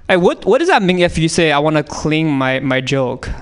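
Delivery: normal talking voice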